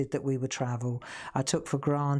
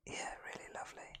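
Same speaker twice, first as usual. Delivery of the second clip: whispered, fast, tender; whispered fondness; 18 dB quieter, faster than usual. Real, not acted. Musing on the kittens' innocence, whispered softly. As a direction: breathe it out in a whisper. Fond aside.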